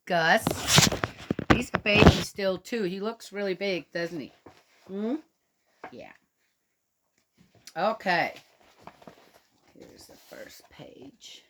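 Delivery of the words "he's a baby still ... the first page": ordinary delivery, nothing marked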